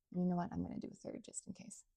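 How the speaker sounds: under-the-breath whisper; whispered aside. Real, not acted. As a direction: whisper it to yourself. Aside while tasting bourbon.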